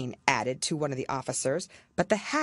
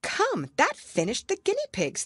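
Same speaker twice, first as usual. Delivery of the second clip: pleased Alice. Acted. Alice voiced.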